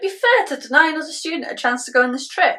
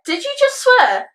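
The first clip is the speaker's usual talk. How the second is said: shocked scolding; 11 semitones higher than usual. Acted. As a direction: pitch leaping high in disbelief. Teacher catches pupil swearing.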